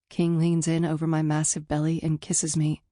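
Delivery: usual speaking voice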